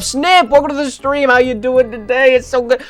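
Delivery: squeaky-high put-on welcome; high gushing greeting. Streamer welcoming Snip to the stream, high-pitched and gushing.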